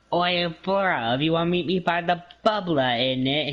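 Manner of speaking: high, hammed-up British lad; mock British accent. Teasing a Wisconsin friend about bubblers, mock British accent. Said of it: "it's not a British thing".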